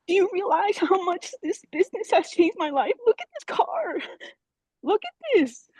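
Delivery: fighting back happy tears; overwhelmed and near tears; tearfully emotional. Real, not acted. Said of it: "I'm so emotional".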